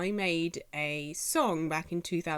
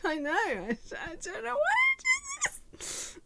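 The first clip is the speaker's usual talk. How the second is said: exasperated squeak. Retold or imitated. Mocking her own self-promotion.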